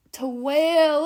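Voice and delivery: loud, climbing, stretched-out word; rising into a wail. Acted. Guide begins the wild woman's wail, rising. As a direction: let the word itself turn into a wail.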